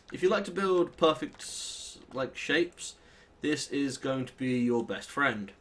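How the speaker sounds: usual speaking voice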